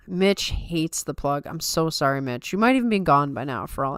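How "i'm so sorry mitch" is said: normal talking voice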